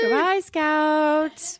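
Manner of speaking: high, very slow goodbye; hammed-up drawn-out send-off; silly farewell. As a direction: sing it out long and high.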